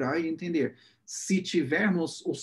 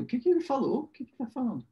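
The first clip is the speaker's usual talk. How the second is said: high confused "O que que ele falou?"; puzzled, asking the friend; confused question; 8 semitones higher, faster than usual. Retold or imitated. Speaker retelling asking his friend, higher and confused. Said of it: "perguntar pro meu amigo".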